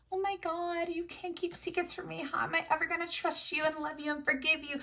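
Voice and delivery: high, whiny mock of a hypocritical character; mocking Violet. Reader mocking Violet's hypocrisy to her viewers, high whiny mock. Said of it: "I wanted to strangle Violet".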